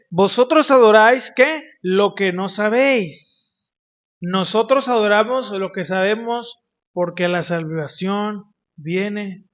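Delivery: loud, ringing scripture read from the pulpit; booming sermon reading, slowing down on the key words; preaching proclamation. Acted. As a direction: proclaim the verse to the whole congregation.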